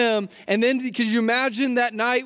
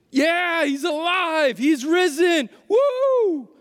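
excited cheer; 6 semitones higher, much slower than usual. Retold or imitated. Disciples imagining Jesus is alive, cheering with joy. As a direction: burst out celebrating.

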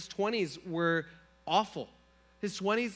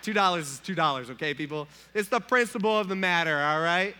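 mock triumph; 7 dB louder than usual. Triumphant retold speech to bank.

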